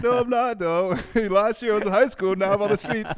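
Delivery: high, loud whining protest with a laugh; whiny young adult. Retold or imitated. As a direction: whine that you're just a kid. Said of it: "You're like".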